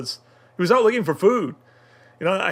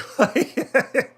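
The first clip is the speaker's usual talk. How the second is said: a sudden high-pitched burst of laughter; high giggle; 10 semitones higher than usual. Real, not acted. Reviewer laughing at the anime gag, high giggle. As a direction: crack up at the joke. Laughing at the boob rack joke.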